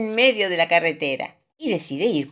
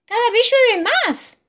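Papa Pig voice; 7 dB louder, slower than usual. A narrator's reading. Papa Pig warns of rain.

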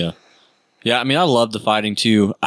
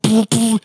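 high poof sound effect; 11 semitones higher than usual. Retold or imitated.

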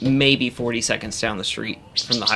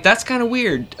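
high awkward voice; 12 semitones higher, 4 dB louder than usual. Retold or imitated. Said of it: "I'm like".

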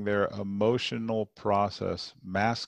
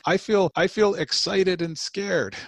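put-on high, slow, breathless child voice; a little boy naming a big feeling; voicing young son; 11 semitones higher, 6 dB louder, slower than usual. Father voicing his son on the stairs, high and slow. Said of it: "And he said".

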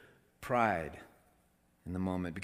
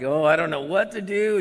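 loud, high frazzled parent; mock helpless exasperation; 7 semitones higher, 9 dB louder than usual. Parent with screaming child in the market, loudly exasperated. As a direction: act out a parent at wit's end.

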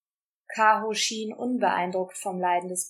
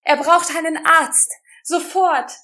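loud urgent shout for a doctor; 7 semitones higher, 10 dB louder than usual. A narrator's reading. Rosa screaming at the guard for a doctor, desperate shout. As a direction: yell it out, desperate and high. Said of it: "schrie sie".

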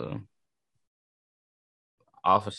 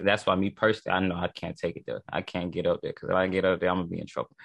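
ordinary delivery

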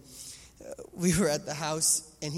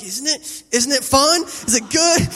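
louder, higher, rising taunts; teasing dad; 7 semitones higher, 11 dB louder, slower than usual. Retold or imitated. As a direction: egg the kids on playfully. Dad teasing in pillow fight.

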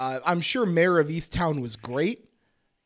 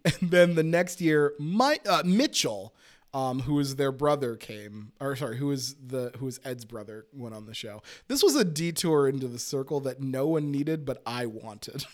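usual speaking voice

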